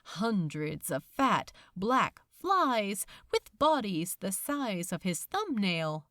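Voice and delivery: plain reading voice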